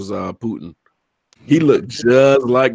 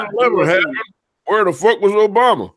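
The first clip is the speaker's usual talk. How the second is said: mock Trump supporter; 10 semitones higher, 4 dB louder than usual. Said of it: "You're like, you're like".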